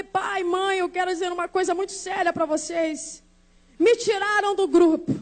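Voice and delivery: usual speaking voice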